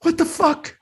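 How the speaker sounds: high, exasperated Sal; Salty Sal. Pretend. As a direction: throw up your hands in outrage. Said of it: "Sal and Harry are out".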